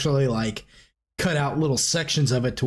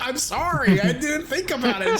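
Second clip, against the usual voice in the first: much higher, sheepish apology; apologetic Otis; 12 semitones higher than usual. Retold or imitated. Otis apologizing to Elsa, high and sheepish. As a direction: squeak out a quick sorry. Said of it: "He's like".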